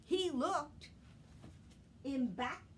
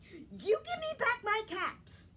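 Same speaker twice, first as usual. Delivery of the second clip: much higher, louder scold at the monkeys; scolding peddler; 12 semitones higher, 6 dB louder than usual. Acted. Peddler scolding the monkeys in the tree, loud and high. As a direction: wag a finger with your voice. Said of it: "he said".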